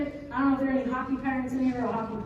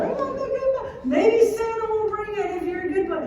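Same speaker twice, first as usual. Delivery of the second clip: put-on much higher whiny child voice, laughing; whiny kid; 9 semitones higher, 4 dB louder than usual. Six-year-old begging for the stick, high and whiny. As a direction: play the pleading little boy. Voicing sobbing son in store.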